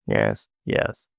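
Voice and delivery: ordinary talk, nothing marked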